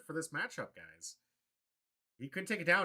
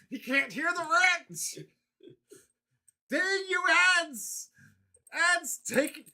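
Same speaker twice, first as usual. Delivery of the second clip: high, loud and slow complaint; real annoyed yelling; frustrated shout; 14 semitones higher, 7 dB louder than usual. Real, not acted. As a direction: rail at the ads. Ad break cuts his rant.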